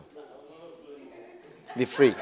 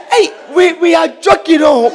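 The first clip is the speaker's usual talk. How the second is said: mock scoffing visitor; 6 semitones higher than usual. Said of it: "You say".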